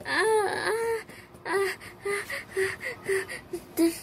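high mock wails and begging; hammed-up terror for the floaty; pretend panic and pleading. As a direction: plead with the spider in a high fake cry.

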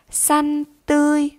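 teacher demonstrates "Xanh tươi" for the class to repeat; model pronunciation. Shown as a demonstration. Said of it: "Các em nghe cô đọc mẫu lại các từ".

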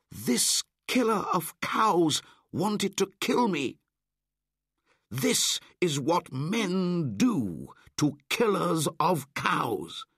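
high, loud, fierce accusation; fierce boy. Acted. Mowgli punishing Shere Khan, fierce. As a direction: strike with every word.

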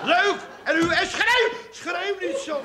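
high, loud pained yell. Acted. Husband yells at wife after she hits him, loud and high. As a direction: yelp it out, stung and furious. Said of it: "dat ik tegen jou geschreeuwd heb".

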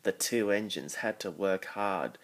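ordinary delivery

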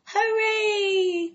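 loud, very high group cheer; cheering shout. Acted. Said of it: "cried everybody".

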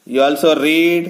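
plain reading voice

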